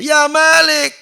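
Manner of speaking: shouted plea. Acted. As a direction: yell his name in despair. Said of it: "Tadi kan teriak-teriak tuh".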